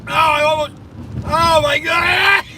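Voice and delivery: panicking as the teeth drop; real alarm. Real, not acted. Driver alone in the car, shouting in real alarm.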